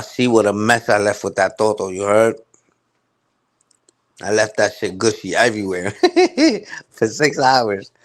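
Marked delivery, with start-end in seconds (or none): 6.0-6.8 sudden high-pitched cackle. Real, not acted. Mocking O G's mess, high cackling laugh. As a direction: burst into a shrill-high cackle.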